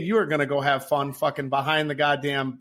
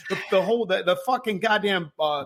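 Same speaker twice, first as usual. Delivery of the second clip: normal talking voice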